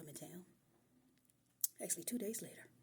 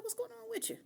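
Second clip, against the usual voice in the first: much higher, innocent questioning tone; high concerned voice. Retold or imitated. Girlfriend puzzled by her crying boyfriend, high and innocent. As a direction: ask it up high, wide-eyed and puzzled. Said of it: "I was like".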